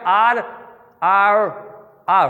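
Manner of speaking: आर with tongue curled back to the palate. Shown as a demonstration. Teacher demonstrating the curled r to students. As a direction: twist the tongue for a rolled आर. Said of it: "अब इसको बोलेंगे कैसे?".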